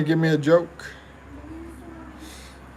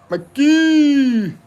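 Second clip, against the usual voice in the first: high, loud, drawn-out name call; playful holler; 10 semitones higher, 6 dB louder than usual. Pretend. Streamer calling out to viewer Mikey, playful high holler. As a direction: holler it high and stretch the ending.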